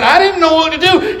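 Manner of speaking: high-pitched, flustered protest; retold panic. Preacher retells boy's confusion as friends flee, mildly panicked.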